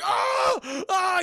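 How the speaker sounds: high-pitched scream of pain; hammed-up screaming, as if burning alive; comic high "ah" screams; mock agonized scream. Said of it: "he's screaming and shit".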